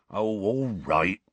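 slow, reluctant surrender; resigned. A narrator's reading. Hagrid agreeing to tell his story, resigned. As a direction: give in with a drawn-out groan of words. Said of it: "said in a resigned voice".

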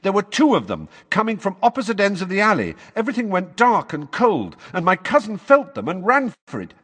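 high, loud, insistent testimony; urgent account. Acted. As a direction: press the story home loudly. Boy urgently insists on his account.

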